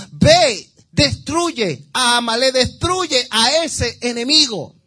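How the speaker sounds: loud, slow divine command; commanding shout. Acted. Preacher booming God's order to destroy Amalek. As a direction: thunder it out like God ordering battle.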